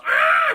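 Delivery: very high, loud mock scream; sudden hammed-up shriek; terrified scream. Han meets a whole battalion, mock scream. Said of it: "He goes".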